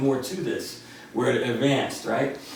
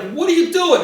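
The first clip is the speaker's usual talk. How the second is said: loud demand; 7 dB louder than usual. Retold or imitated. People yelling at the door.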